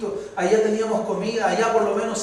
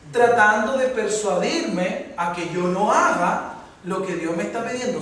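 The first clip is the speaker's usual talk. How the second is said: normal talking voice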